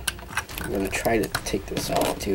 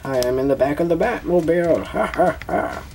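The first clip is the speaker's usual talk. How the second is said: villain voice; 7 semitones higher than usual. Pretend. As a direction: play a cartoon villain bragging. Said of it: "That's, that's my villain voice".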